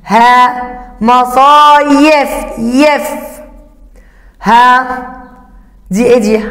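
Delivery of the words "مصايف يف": مصايف slowed, then the syllable يف isolated; teacher isolating يف in مصايف; sounding out. Shown as a demonstration. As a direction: split مصايف and pull out يف. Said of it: "ننطق".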